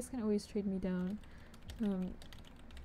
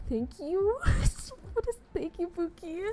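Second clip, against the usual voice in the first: much higher, laughing squeal; laughing, delighted; giddy disbelief; 8 semitones higher, 4 dB louder than usual. Real, not acted. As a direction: squeak out thanks, overwhelmed. Overwhelmed by a gift.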